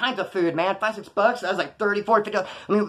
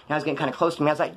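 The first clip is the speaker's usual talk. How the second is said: plain reading voice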